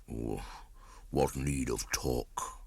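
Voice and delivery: put-on an old bear too tired to argue; slow old bear. A narrator's reading. Old bear answering Mowgli's lament, slow and soft. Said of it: "said Baloo slowly".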